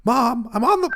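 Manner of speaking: put-on high, loud teenage whine; cool act drops into a shrieked protest; exasperated yell at mom. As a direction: yell at your mother to go away. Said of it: "your voice changed a little bit".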